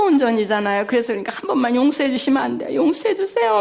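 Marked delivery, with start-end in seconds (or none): 1.3-3.6 fast, much higher wheedling plea; cute pleading. Retold or imitated. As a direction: beg sweetly for one pardon. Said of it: "애교를 떠는".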